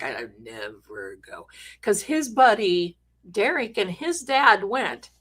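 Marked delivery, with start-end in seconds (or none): 0.0-1.5 mumbled, much lower and much softer; embarrassed son. Retold or imitated. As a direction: barely mutter it like a mortified teenager. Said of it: "He's like".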